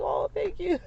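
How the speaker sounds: high, gushy mock thank-you; flattered thanks. Mother answering the compliment, high flattered thanks. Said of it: "And I was like".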